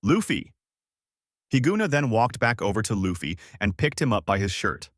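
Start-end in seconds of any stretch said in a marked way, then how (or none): 0.0-0.5 yelled. A narrator's reading. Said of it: "Goku yelled out".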